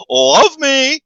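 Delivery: high, loud, hammed-up Jim Carrey begging; mock Jim Carrey plea. Jim Carrey, as retold on the podcast, begging the lobby, hammed-up and high. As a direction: squeal it out like a goofy star begging for attention. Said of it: "He goes, "I just come through the lobby and just go".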